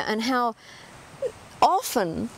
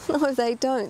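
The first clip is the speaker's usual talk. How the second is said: ordinary talk, nothing marked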